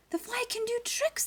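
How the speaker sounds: high amazed exclamation; amazed judges. Acted. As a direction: sound astonished at the trick.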